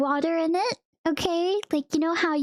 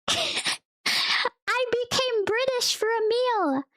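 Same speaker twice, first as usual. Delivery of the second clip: laughing; 8 semitones higher, slower than usual. Real, not acted. Joking she became British.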